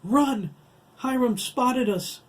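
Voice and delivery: high, loud alarm; urgent shout. Acted. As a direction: yell the warning while running. Craig warns Virginia, fleeing.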